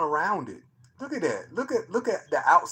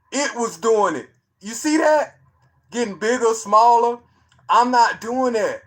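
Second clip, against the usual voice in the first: louder, higher, emphatic denial; insistent; 6 semitones higher, 8 dB louder than usual. Real, not acted. Swearing to the chat he isn't zooming, loud insistent denial. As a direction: push it hard, you're not faking it.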